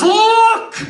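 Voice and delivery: high, very loud bellow; angry dad yell. Acted. Dad yelling at his son over a mess, angry shout. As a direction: shout it as an angry dad's order. Dad orders him to look, acted.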